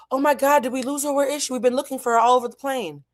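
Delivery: mildly flustered, voicing someone searching; worried flight attendant. Flight attendant searching for missing passenger.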